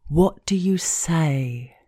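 slow, drawn-out teasing question; slow prompting. Acted. Narrator prompting the man for the magic word, slow prompting. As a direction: stretch the question out, waiting for the answer.

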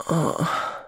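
soft breathy moan. Acted. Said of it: "I moaned".

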